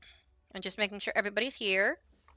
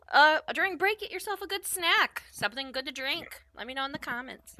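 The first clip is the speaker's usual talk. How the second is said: ordinary talk, nothing marked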